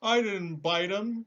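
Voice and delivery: high, loud, slow lion voice; a big cowardly lion protesting. Acted.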